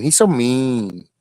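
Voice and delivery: put-on slow, pouty baby voice; mock whiny. Teasing Mayhem in a pouty baby voice. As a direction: drag it out like a sulking child.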